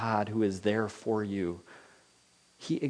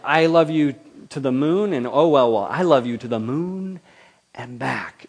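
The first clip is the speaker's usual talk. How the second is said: loving declaration; 3 semitones higher, 7 dB louder than usual. Retold or imitated. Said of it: "and it's like".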